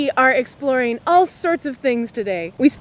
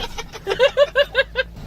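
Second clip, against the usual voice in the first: high breathless laugh; 12 semitones higher than usual. Real, not acted. After the scare.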